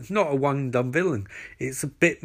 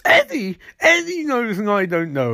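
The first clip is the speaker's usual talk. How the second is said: hammed-up incredulity, pitched way up then sliding down; mock outraged disbelief; 6 semitones higher than usual. [name] learns Eddie was told first, mock outraged disbelief.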